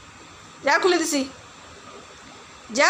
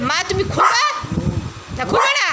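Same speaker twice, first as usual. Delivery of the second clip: higher, scolding dog voice; voicing the dog scolding; 5 semitones higher than usual. Owner voices dog Leo scolding her about the door, mock complaint. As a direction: speak for the dog, complaining to mom. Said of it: "বকা দিতে আসছে".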